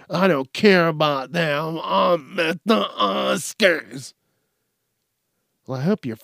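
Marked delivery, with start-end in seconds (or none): 0.1-4.1 slow, drawn-out, high put-on voice; mock self-absorbed celebrity. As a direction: play a vain Oscars guest, stretching the words. Voices the self-absorbed dress wearer.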